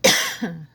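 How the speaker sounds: sharp dry cough from a throat tickle. Real, not acted. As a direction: cough once, dry and sudden. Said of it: "I have a tickle in my throat".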